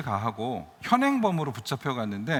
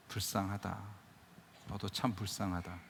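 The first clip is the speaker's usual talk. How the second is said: softer, lower, gently pitying; pitying tenderness; 4 semitones lower, 10 dB quieter than usual. Retold or imitated. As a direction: say it softly to someone you have forgiven. Forgiving the man who hurt him. (용서한 사람에게 부드럽게 말하는 연민)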